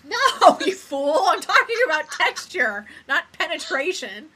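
loud, high-pitched mock scolding; hammed-up exasperation, pitched well up; mock indignation. Co-host scolds her friend for mistaking texture, in mock indignation.

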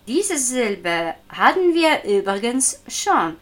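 ordinary talk

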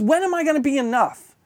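impassioned preaching rebuke; God's rebuke. Retold or imitated. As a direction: hammer home what God has done, rising in force. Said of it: "When he says".

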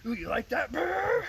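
high, loud hammed-up taunt ending in a brr; mock lewd teasing. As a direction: act out a crude imagined encounter. Crude joke acting out the scene.

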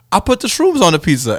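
alarmed confession. Retold or imitated. Friend tells pizza eater about the shrooms, mock alarm. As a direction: act out breaking alarming news.

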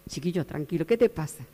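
put-on soft, gentle calming of a frightened boy; quiet, tender reassurance; the Lord calms the frightened prophet, soft and gentle; soothing.

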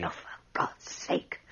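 breathy hissed aside; exasperated whisper. Acted. Son whispering in irritation at his father. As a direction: whisper it under your breath, fed up.

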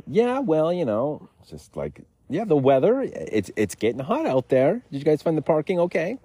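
high, bright forced chit-chat; fake-friendly server voice; chirpy waiter small talk.

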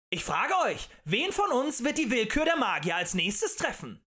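high, loud orator's pitch; impassioned tavern agitator; rabble-rousing harangue. A narrator's reading. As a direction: stir up a crowd with indignation. Agitator challenges the crowd.

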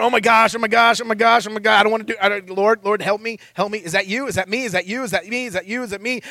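hammed-up anxious self-talk; mock inner panic. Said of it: "'cause I'm screaming at myself".

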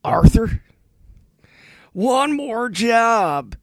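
slow country cowboy drawl; a western outlaw, drawn out slowly. Acted. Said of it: "deeper and more country, I could be in Red Dead Redemption".

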